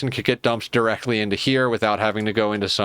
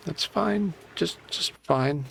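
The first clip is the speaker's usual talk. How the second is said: "fine" pitched much higher, softer and falling, mock-cheery; sarcastic fine; 7 semitones higher, 5 dB quieter, slower than usual. Real, not acted. To himself after a blocked pipe, sarcastic and high. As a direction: pretend all is well through gritted teeth.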